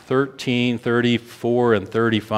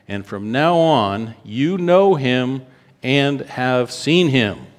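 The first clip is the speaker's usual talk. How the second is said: slow, building to a high ringing "life"; rising preaching cry; 5 semitones higher, 3 dB louder, slower than usual. Acted. Preacher declaring Jesus to the congregation, climbing. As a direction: swell to a shout on the last word.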